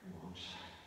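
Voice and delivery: usual speaking voice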